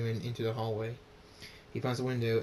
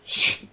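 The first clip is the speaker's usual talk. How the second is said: short sharp hissed effect; explosive hiss; loud shh noise; 12 dB louder than usual. A narrator's reading. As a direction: make a sudden loud whoosh. Sound effect of glowing horn.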